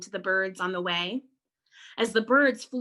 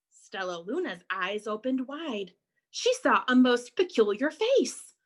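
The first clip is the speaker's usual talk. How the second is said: usual speaking voice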